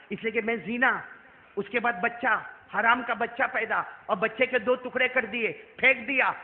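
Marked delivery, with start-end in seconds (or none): none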